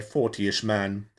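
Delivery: plain reading voice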